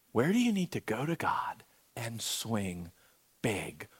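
softly whispered question, hushed and intimate; hushed prayerful whisper. Shown as a demonstration. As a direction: breathe it to the room so it stays quiet. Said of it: "It's gonna be quiet".

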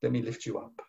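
a hint of a loving father lifting a child; softly voicing God's comfort; tender voice of God. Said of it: "the Lord's hand reaches out and says".

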